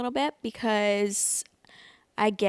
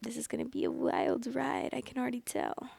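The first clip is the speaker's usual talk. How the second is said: hushed, much softer whisper; whispered aside; 4 semitones higher, 7 dB quieter than usual. Real, not acted. Vlogger reacting to the story title, in a whisper. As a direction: lean in and whisper it like a secret.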